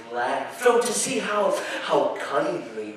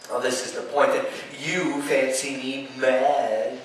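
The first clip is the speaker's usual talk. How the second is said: ordinary delivery, nothing marked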